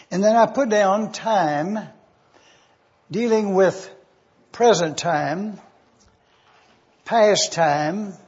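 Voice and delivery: normal talking voice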